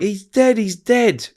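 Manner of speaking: high-pitched imitation of a distraught mum; urgent, high retold scream. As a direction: cry it out high, not at full volume. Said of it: "she's screaming, screaming".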